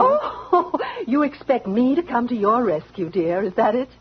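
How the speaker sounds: scornful laugh that spills into the words; a jeering laugh, then the taunt; mocking laugh. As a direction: laugh at her plea for help. Daisy mocks Helen's plea.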